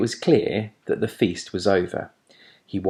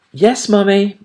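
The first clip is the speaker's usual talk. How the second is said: put-on high young voice; child owl voice; 12 semitones higher, 7 dB louder than usual. A narrator's reading. Young owl answering his mother, high and childlike.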